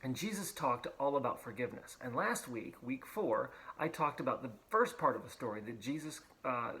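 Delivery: ordinary delivery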